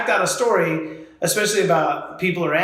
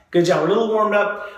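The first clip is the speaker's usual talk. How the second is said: ordinary delivery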